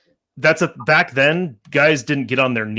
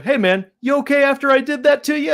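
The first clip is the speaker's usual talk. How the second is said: imitating his joky check-in, higher pitched; mock a jokey "you okay?" in a raised voice; joking; 4 dB louder than usual. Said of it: "just, like, kind of in a joke".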